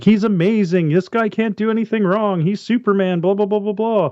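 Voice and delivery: hyped-up mock praise, running on; mock gushing. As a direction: imitate an announcer raving about one player. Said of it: "And he's like".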